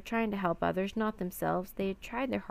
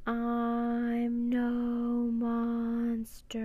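very slow, high, sustained chant; eerie creature voice; 4 semitones higher, much slower than usual. A narrator's reading. Octopus speaks to the stunned crew, eerie and drawn-out. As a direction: stretch every word into one long mournful call.

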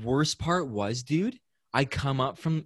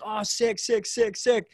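excited sick sick sick; 9 semitones higher, slower than usual. Retold or imitated. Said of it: "And he goes".

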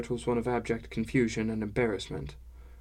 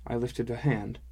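ordinary delivery

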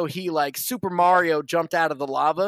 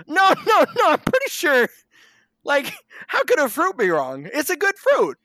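high-pitched, hammed-up tempter's protest; squeaky-high mock reassurance; mock-innocent coaxing; 9 semitones higher, 4 dB louder than usual. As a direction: talk them round like a dim, comic Satan. Satan coaxing Adam and Eve.

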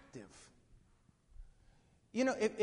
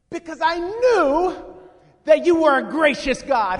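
enraged; 7 semitones higher, 13 dB louder than usual. Acted. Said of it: "he is absolutely enraged".

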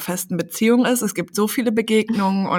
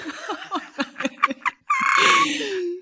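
burst of laughter. Real, not acted. Laughing at flies mating.

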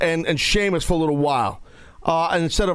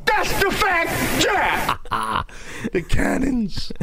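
pirate voice; 8 semitones higher, much slower than usual. Pretend. Pirate bit continues.

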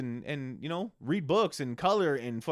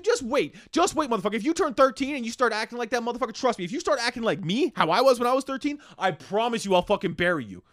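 put-on unload on the kid like he can hear you; mock furious rant at son; 6 semitones higher than usual. Venting rage at eight-year-old son.

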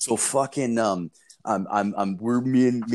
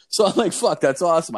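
excited; 8 semitones higher, 5 dB louder than usual. Retold or imitated. Delighted after the jig.

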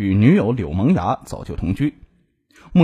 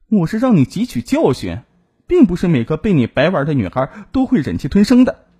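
higher-pitched, mildly angry comeback; defiant retort; 6 semitones higher, 3 dB louder than usual. A narrator's reading. Wronged woman answers her ex, defiant. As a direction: teach him a lesson, voice raised in pitch.

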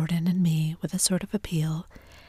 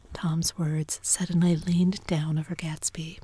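ordinary delivery, nothing marked

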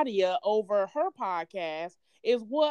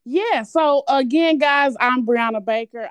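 energetic intro; 10 dB louder than usual. Real, not acted. Guest starts introducing herself.

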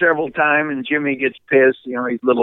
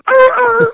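two high, loud yelps; imitated dog yelps; 5 dB louder than usual. Hunter mimicking dog on upper shock level, imitated yelps. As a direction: mimic a zapped dog yelping twice.

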